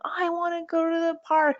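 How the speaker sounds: high childish voice, mild mock yell; imitating a child. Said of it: "he'll just keep yelling it".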